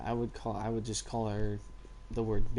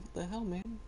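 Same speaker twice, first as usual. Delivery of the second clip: higher, baffled little dog voice; confused dog voice; 9 semitones higher, 7 dB quieter than usual. Pretend. Dog dazed after hitting the cage, higher and baffled. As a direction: voice the dog as a dazed, puzzled pet.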